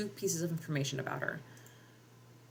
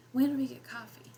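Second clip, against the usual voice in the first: higher, pleased little question; delighted surprise; 7 semitones higher than usual. A narrator's reading. Mother surprised by the coffee, delighted question. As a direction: ask it with sleepy delight. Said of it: "almost delightedly".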